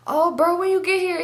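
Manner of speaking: imitating a high-pitched, surprised cousin; surprised greeting. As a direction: act out finding a buddy unexpectedly there. Cousin wakes, finds him there.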